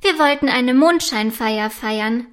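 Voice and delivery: ordinary delivery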